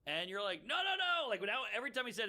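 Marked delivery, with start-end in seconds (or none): 0.7-1.3 mock protest. Reacting to friend's story.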